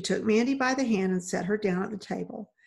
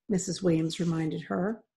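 plain reading voice

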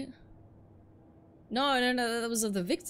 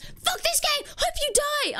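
high, loud mock gamer fury; mock rage scream; 5 dB louder, slower than usual. Teammates raging at each other, mock scream. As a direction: scream it like a tilted player. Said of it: "In the span of five minutes".

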